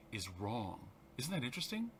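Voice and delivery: ordinary talk, nothing marked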